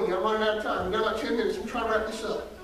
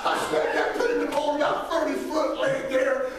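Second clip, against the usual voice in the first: ordinary talk, nothing marked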